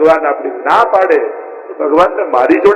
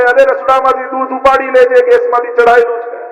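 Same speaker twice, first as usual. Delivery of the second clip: higher pitched mock shout toward the kitchen; mock calling out; 7 semitones higher than usual. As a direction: imitate someone yelling across the house, half-hearted. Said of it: "એવી બૂમો પાડે". (ઠાકોરજી આગળ બેઠા બેઠા રસોડા તરફ હળવી નકલી બૂમ)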